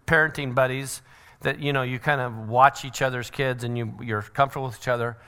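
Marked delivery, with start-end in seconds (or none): none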